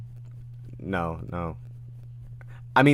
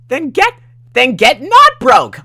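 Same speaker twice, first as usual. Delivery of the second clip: very high, slow character shout; Dandy; 15 dB louder, slower than usual. Acted. Character arguing back at a broke streamer, high shouted voice. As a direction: shriek the order, stretching each word. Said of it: "read this in my Dandy voice".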